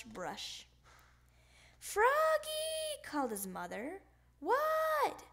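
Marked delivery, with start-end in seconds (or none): 1.8-3.0 calling out. Acted. Mother calling across the house. 4.4-5.2 put-on child's answer. Froggy answering mother's call.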